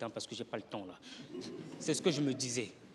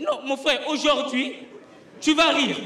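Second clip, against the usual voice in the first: loud, high pleading cry; a comic shouting to a heckler that he will laugh; pleading yell; 7 semitones higher, 7 dB louder, slower than usual. Retold or imitated. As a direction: beg the crowd to give you a chance. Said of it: "moi, je dis".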